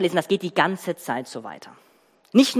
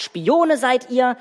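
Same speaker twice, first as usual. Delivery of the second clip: slow, higher mock accusation; retold accusation; 6 semitones higher, 4 dB louder, much slower than usual. Preacher quotes Joseph's charge against the brothers, mock stern. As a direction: recall the ruler's charge, half-acted. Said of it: "Josef sagt".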